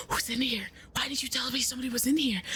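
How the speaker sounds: retold frantic whispering; urgent whisper. Whispering to a coworker about who's in the room, urgent whisper. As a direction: whisper it like you're afraid of being overheard. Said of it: "started whispering".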